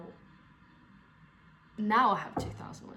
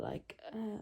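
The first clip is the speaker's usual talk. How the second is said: ordinary talk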